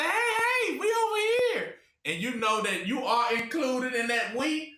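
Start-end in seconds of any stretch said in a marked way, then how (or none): 0.0-1.7 high, calling across the field. Retold or imitated. A friend calling the lost warrior over, high call. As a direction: hail them from far off.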